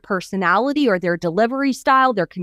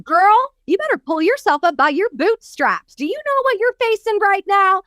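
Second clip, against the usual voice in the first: higher, sassy tough-love scolding; mock tough coach; 8 semitones higher than usual. Doctor Phil scolding Oprah at night, sassy tough love. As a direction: scold her like a no-nonsense coach. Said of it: "He was saying".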